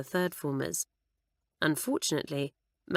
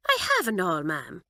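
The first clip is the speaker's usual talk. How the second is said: put-on solemn Irish girl. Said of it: "Kitty would reply solemnly".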